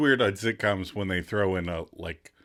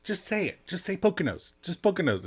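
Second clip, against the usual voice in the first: pushy nagging; 10 semitones higher than usual. Retold or imitated. Rachel nagging Paolo in quote.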